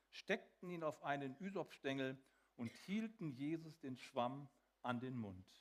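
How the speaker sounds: normal talking voice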